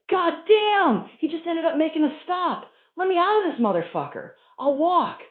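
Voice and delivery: high, loud, fed-up protest; hitchhiker's desperate outburst. Acted. Hitchhiker desperate to leave the car, high disgusted shouting. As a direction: beg loudly to get out of the stink. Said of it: "He's going".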